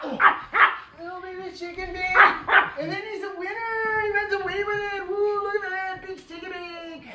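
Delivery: very high sing-song pet talk. Real, not acted. Baby talk to dog.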